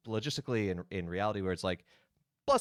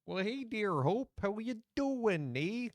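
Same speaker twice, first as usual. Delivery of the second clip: higher, slow, hammed-up Midwestern accent; bad Midwestern accent; 10 semitones higher, slower than usual. Greeting a chatter named Hope, in a bad Midwestern accent. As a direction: greet chat like a cheery Midwesterner, drawn out. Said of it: "bad Midwestern accent when you come into chat".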